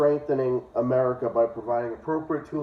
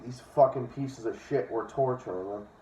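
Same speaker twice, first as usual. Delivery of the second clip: ordinary delivery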